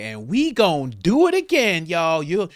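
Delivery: mock a politician's put-on Southern twang; exaggerated fake down-home twang; fake Southern accent. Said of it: "she's like".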